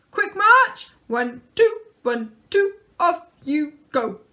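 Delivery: barked loud marching orders; sergeant-major drill call. A narrator's reading. Dad marching his kids off to chores, loud drill orders.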